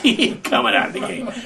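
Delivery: said through a breathy laugh. Retold or imitated. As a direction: laugh while you quote it. Coach retelling defying the kid.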